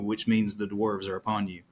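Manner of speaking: ordinary delivery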